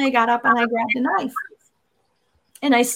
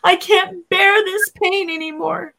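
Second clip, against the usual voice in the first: higher, louder cry out to God; reliving a desperate, raised-voice plea; anguished yell; 5 semitones higher, 4 dB louder than usual. Retold or imitated. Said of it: "I yelled out at God".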